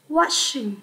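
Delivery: "washing" said slowly, ending -ing clear; slow model word. Shown as a demonstration. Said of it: "đọc là". (đọc chậm "washing", rõ đuôi -ing)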